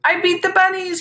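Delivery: a high, loud victory whoop. A narrator's reading. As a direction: crow it out like you won. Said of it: "he whooped".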